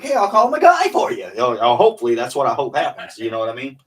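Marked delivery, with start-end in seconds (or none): 0.0-1.3 high, loud impression of a rich customer; rich client voice. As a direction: play an eager rich customer.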